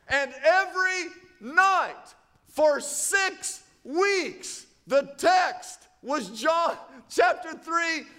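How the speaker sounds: high, slow, ringing pulpit proclamation; full-force preaching. Real, not acted. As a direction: hammer each phrase out to the whole church.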